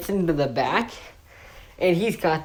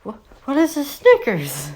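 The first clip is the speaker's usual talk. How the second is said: baffled cops; 6 semitones higher than usual. Retold or imitated. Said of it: "They're like".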